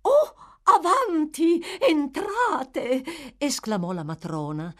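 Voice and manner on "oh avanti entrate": high, slow, honeyed welcome; much sweeter tone. A narrator's reading. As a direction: coo the invitation sweetly. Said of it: "in un tono di voce di gran lunga più soave".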